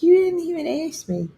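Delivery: high, indignant dog voice; voicing the dog. Owner voicing her dog's huffy reply, high-pitched. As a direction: pipe up like an offended little dog. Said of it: "She's like".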